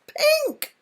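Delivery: high, loud chirping shout; shouted bird call. A narrator's reading. Chaffinch shouting to Miggy in excitement, high and loud. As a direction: shout it like a proud little finch. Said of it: "shouted the little chaffinch".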